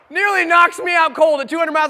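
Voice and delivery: ordinary delivery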